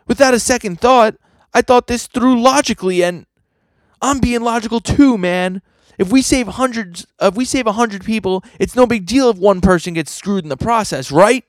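very high, loud cartoonish protest; defensive arguer. Character justifies a scheme as logical, high-pitched and defensive.

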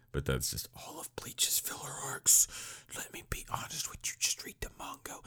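hushed, confidential whisper; under-the-breath whispered aside. Pretend. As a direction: whisper it like a secret tip. Whispered aside about filler arcs.

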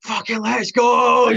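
loud, high, drawn-out battle roar; full-volume hype yell, stretched out; imitated screaming. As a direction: bellow it slowly like rallying a team.

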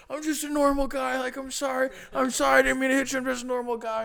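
high-pitched imitated apology; pleading driver. Retelling the driver apologizing to him, high mock pleading.

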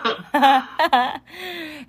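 real burst of high laughter; high, amused laugh. Real, not acted. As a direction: crack up at the memory. Laughing over shared deck memory.

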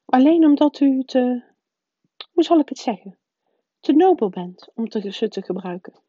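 the strict witch pressing her point; stern professor voice. A narrator's reading. McGonagall voiced by the narrator.

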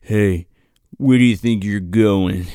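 low mock-boyfriend challenge; imitated controlling boyfriend. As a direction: drop the pitch, play the domineering boyfriend. Controlling boyfriend voiced in retold scene.

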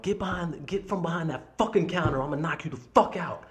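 high-pitched mock scream, held back in volume; retold screaming threat. Customer screaming at manager behind counter, mock fury. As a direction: imitate a furious threat without full volume. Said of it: "I'm just screaming at this guy".

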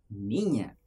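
low, baffled mock question; puzzled echo. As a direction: echo the word in low disbelief. Puzzled reaction to being called niña. (repetición grave y desconcertada)

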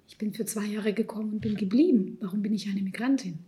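ordinary delivery

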